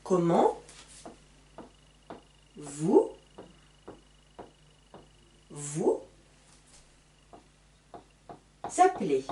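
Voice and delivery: each word set apart with long pauses; slow dictation. Shown as a demonstration. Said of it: "ici j'ai le son en".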